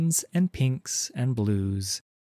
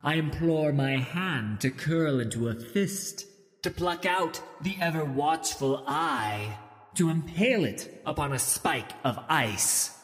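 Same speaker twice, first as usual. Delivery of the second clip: eerie witch prophecy; 5 semitones higher than usual. Acted. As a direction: intone it like a distant witch. Ice Witch's prophecy continues.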